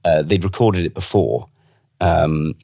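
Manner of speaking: usual speaking voice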